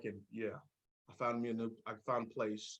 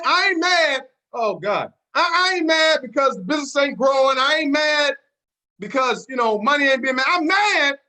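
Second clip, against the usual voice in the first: higher, louder mock rant, shouting at times; acted-out anger; 5 semitones higher, 4 dB louder than usual. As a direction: play the fed-up recruit venting at the company. Acting out his past anger.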